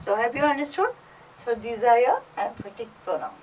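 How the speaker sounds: usual speaking voice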